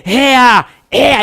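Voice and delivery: loud ringing cry of ea; loud shout of victory. Shown as a demonstration. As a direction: shout it out like a war horse. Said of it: "el caballo de la fe dice". (grito fuerte de victoria)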